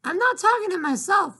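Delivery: high, loud shout back downstairs. Acted. Girl shouting down to her mother, high and loud. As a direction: yell it down to mom. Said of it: "Flora shouted".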